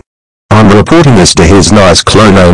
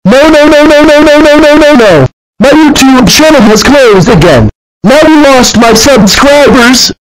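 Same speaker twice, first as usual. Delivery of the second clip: high crying wail; 4 dB louder than usual. Acted. Channel deleted, crying out.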